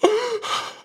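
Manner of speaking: high-pitched imitated sob-gasp; hammed-up sharp, squeaky intake of breath; sobbing gasp. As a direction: gasp like a mock sobber. Imitating the crying, furious girl.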